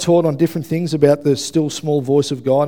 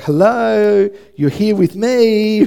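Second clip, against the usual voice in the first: mock wifely call, higher and louder; drawn-out, sing-out "I'm over here" nudge; wife's pointed reminder; 6 semitones higher, 5 dB louder, much slower than usual. Wife calling back her distracted husband over coffee, loud mock reproach. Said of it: "And she's often like".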